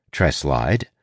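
usual speaking voice

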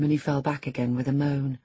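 ordinary delivery, nothing marked

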